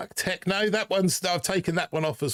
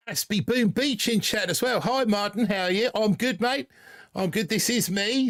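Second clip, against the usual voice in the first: a put-on Aussie accent, pitched higher; dodgy Australian accent; 8 semitones higher than usual. Streamer greeting chat members in a dodgy Australian accent. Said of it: "the dodgy Australian accent".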